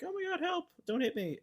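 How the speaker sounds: high, squeaky mock plea for help; hammed-up high cry for help; mock panic. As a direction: play a panicked victim for laughs. Voices flailing victim in retelling.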